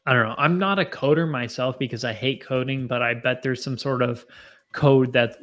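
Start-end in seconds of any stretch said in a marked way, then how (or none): none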